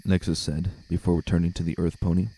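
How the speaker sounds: ordinary delivery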